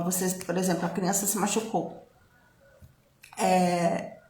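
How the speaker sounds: normal talking voice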